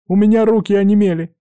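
high, loud desperate wail; pleading. Acted. Man stuck on the mast to his rescuers, desperate pleading. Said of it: "взмолился Горинц".

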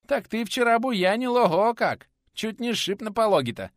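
high, loud lisping reproach; teasing peasant. Acted. Nikodim reminding the teacher of his rampage, high loud lisp. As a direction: rib him like a toothless old craftsman.

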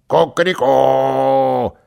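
long, drawn-out crowing; rooster crow. A narrator's reading. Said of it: "точь-в-точь, как кричат настоящие петухи".

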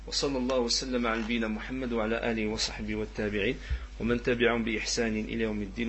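low, soft formula of blessing; closing prayer. Real, not acted. Teacher ending the lesson with blessings, closing prayer.

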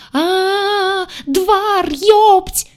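high, loud rising shriek that breaks into a curse; deafening wail. Acted. Redhead bursts into the cabin, shrieking at everyone. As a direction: wail the name until it cracks into a curse. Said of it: "оглушила воплем".

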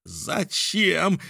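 high, incredulous cry; outcry. A narrator's reading. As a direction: throw the question back high. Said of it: "вскричал Мишель". (возмущённый вскрик)

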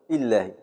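إلهي said as ilːahi with the ya elided; ya dropped. Shown as a demonstration. Teacher demonstrating the third dialect form. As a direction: run إلهي together, no ya before the lam. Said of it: "نحذف أحدهما".

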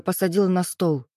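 usual speaking voice

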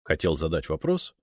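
ordinary delivery

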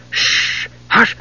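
whispered hush; urgent shush. Acted. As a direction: hiss him quiet urgently. Tas hushing Palin urgently.

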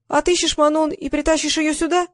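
high-pitched doubtful question. A narrator's reading. Girl questioning Liza's promise to save the show, doubtful. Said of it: "с сомнением спросила".

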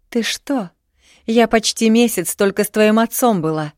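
high, loud indignant protest. A narrator's reading. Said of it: "возмутилась Марина". (возмущённо, громко и высоко)

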